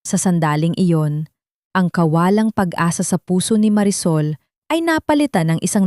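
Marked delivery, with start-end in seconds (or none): none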